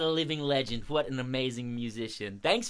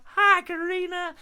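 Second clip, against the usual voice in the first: very high sing-out hello; high echoed greeting; 6 dB louder than usual. Pretend. Audience greeting the crow, high-pitched call.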